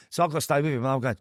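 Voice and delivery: ordinary talk, nothing marked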